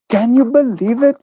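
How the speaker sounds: high, playful can-you-believe-it; mock disbelief. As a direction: tease the chat with fake amazement.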